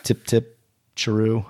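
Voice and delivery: hammy British farewell; mock British accent. Cohost parodying a British person for his friend, mock British accent. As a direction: play at being British with a put-on accent. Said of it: "not what a British person sounds like".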